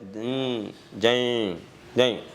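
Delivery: low, drawn-out pumping noise; sluggish heartbeat. Shown as a demonstration. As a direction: drag each beat out wearily, with gaps. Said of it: "கொஞ்சம் மெதுவா தான் தள்ளுது". (மெதுவா இழுத்து துடிக்கும் இதயம் போல)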